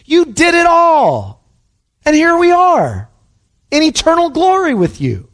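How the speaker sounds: slow, high cries of thanksgiving; exultant praise. Acted. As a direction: cry each line up to God, building to a peak. Acts out saints praising God.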